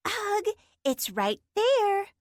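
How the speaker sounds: Sparky groaning at Harrison who cannot see the cave; exasperated groan. Acted. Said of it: "Sparky groaned".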